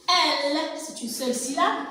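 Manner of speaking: normal talking voice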